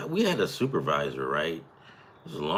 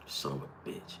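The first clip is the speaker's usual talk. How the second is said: whispered aside, much softer; muttered curse; 9 semitones lower, 17 dB quieter than usual. Real, not acted. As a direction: curse under your breath at the screen. Aside cursing the game.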